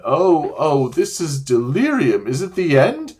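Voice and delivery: wailing. Acted. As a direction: wail and complain in self-pity. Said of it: "it was wailing on".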